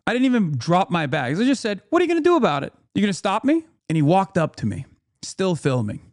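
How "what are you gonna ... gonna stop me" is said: high-pitched, daring comeback; confrontational challenge. Retold or imitated. Bags in hand, challenging the heckler, confrontational. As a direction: dare him to step in.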